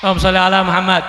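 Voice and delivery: fast, loud blessing call; leading the crowd in salawat; quick salawat. Real, not acted. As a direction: rattle off the blessing. Preacher leads salawat quickly.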